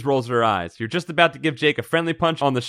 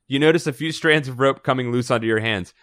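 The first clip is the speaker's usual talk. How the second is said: ordinary talk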